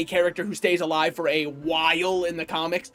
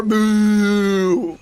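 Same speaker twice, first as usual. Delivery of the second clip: playful boo; 6 dB louder than usual. Pretend. Playful jump scare.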